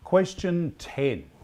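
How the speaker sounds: slow, loud question call; quizmaster announcement. Acted. Quizmaster calling the next question to the pub, slow and loud. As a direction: announce it slowly to the room.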